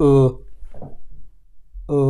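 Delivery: ও said as a rounded German ö, lips pursed and tongue forward; German ö sound. Shown as a demonstration. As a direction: shape ও into the umlaut ö, not the English o. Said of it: "ভিতরে একটু ভাঁজ হয়ে তারপরে কথাটা আসবে".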